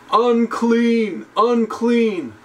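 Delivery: high, calling cry of unclean from afar; leper crying out. Retold or imitated. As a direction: call out like an outcast leper warning the crowd. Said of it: "You're supposed to hear from a distance".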